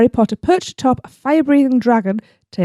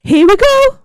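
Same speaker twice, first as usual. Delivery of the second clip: very high, rousing cry; excited character call; 14 semitones higher, 4 dB louder, slower than usual. Acted. Friends whisk riders away from battle, excited high cry. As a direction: shout it high to rally riders. Acting rescuer calling out in battle.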